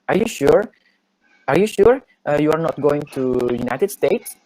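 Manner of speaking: mock astonished examiner, pitch climbing; surprised examiner. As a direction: play the examiner, disbelieving and high. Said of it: "Terus nanya kayak gini".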